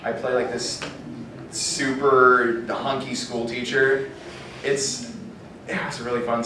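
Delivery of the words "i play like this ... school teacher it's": normal talking voice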